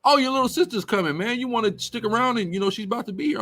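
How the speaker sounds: high, chatty imitation of the friend; sister's best friend. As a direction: pitch it well up as the friend inviting someone to stay. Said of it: "My little sister's best friend just comes out".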